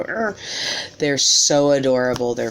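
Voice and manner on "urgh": high squeezed groan at cute babies; cute overload groan. Retold or imitated. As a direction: groan like you want to squish them. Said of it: "you just wanna go".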